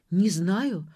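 ordinary delivery, nothing marked

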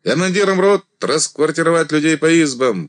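high, loud parade order; shouted command. A narrator's reading. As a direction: call the order across the ranks. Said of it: "приказал Андрей".

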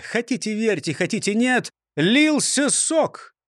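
ordinary delivery, nothing marked

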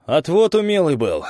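hoarse rasp while getting up; wheezing. Acted. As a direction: croak it out. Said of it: "прохрипел дядя Миша".

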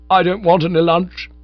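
loud, high sulky refusal. A narrator's reading. Heartbroken Bingo refusing lunch from Bertie, loud and sulky. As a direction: refuse flatly, hurt.

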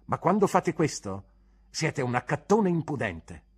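heated, contemptuous verdict; insolent insult. Acted. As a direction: drive the insult home at the teacher. Pupil insults teacher.

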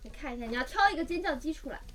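usual speaking voice

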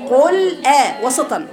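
قل آ slowly, a short stop between ل and آ; separated qul a. Shown as a demonstration. Teacher corrects the student's joined reading, slow. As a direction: pause lightly after قل before آ. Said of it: "ما تقوليش قل آ".

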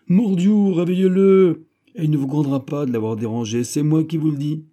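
bien plus haut et fort, rapide; ordre impérieux. A narrator's reading. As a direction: tonne son ordre sans réplique. D'Artagnan exige qu'on réveille le roi. (d'Artagnan ordonne à Perry de réveiller le roi, voix forte et pressée)

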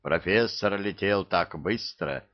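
ordinary delivery

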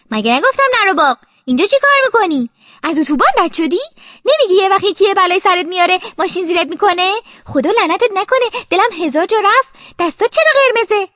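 loud, high-pitched telling-off; angry scolding mother. Acted. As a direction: scold a child who frightened you. Mother scolds child for running off.

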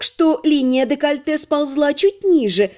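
usual speaking voice

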